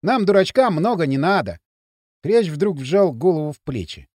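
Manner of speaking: usual speaking voice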